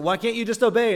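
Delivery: mock scolding a Bible figure; frustrated outburst. Reader yelling at an Old Testament figure, exasperated. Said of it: "you get really frustrated".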